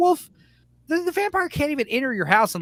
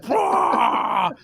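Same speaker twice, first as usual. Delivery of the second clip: loud rolling beast roar as it bursts in; shouted werewolf roar; 4 semitones higher, 3 dB louder than usual. Retold or imitated. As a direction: burst in roaring.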